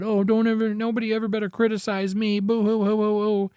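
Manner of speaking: high, fast mock-whiny complaint with a fake boo-hoo; sarcastic crybaby impression; mock whining. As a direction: whine like a crybaby who can't take criticism. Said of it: "whine and cry and complain".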